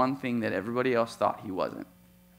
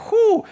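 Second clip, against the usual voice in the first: exasperated whew; 12 dB louder than usual. Real, not acted. Overwhelmed by five kids' demands.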